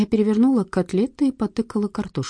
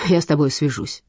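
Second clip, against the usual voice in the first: usual speaking voice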